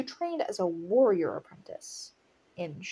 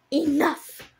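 loud, higher acted outburst; exasperated shout to silence them; shouted command; 7 semitones higher, 8 dB louder than usual. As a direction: cut the argument off sharply. Acted order cutting off argument.

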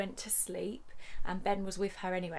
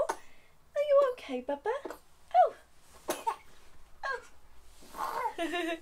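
much higher, slow cooing voice; 14 semitones higher, much slower than usual. Real, not acted. Mother checking on baby.

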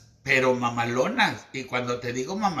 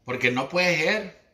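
imitating el Peje; 4 semitones higher than usual. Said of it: "como dijo el peje".

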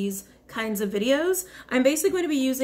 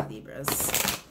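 whisper; 12 semitones lower, 5 dB quieter than usual. Acted. Calling the sign dramatically.

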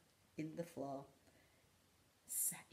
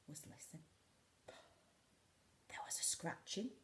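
whisper; 6 dB quieter than usual. A narrator's reading. Suspenseful listening.

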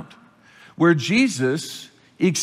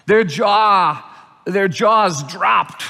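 slow, louder, each word set apart; stunned emphasis; 3 semitones higher, 5 dB louder, much slower than usual. Shown as a demonstration. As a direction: let the amazement land slowly. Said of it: "say it this way".

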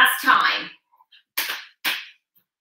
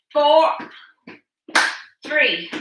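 ordinary talk

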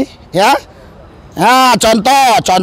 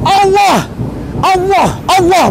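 Allah said the Arabic way with a rounded final, higher; Arabic pronunciation of Allah; 6 semitones higher, slower than usual. Retold or imitated. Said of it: "Mereka bukan seperti orang Indonesia".

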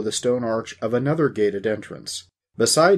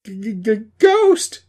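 high, loud, stuttered shock; frightened stammer exploding into a shout; stammering blurt; 13 semitones higher, 9 dB louder than usual. A narrator's reading. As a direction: stutter on the first sound, then burst out with the word. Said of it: "Dusty blurted out".

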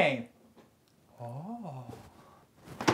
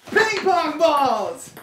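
excited announcing; 5 semitones higher, 5 dB louder, slower than usual. Real, not acted.

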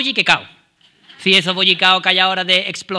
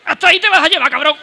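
agudo y fuerte, plantándose; niño que defiende su bocadillo, medio enfadado; 5 semitones higher than usual. Retold or imitated. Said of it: "y tú decías". (enfadado, agudo y fuerte)

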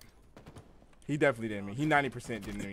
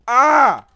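triumphant yell; 12 semitones higher, 19 dB louder than usual. Real, not acted. Gamer cheers after a kill.

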